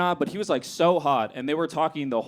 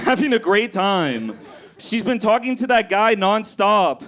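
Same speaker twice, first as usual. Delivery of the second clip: frantic note read; 3 semitones higher than usual. Shown as a demonstration. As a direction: read the panicked note to yourself. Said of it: "I'm gonna read you guys what I wrote".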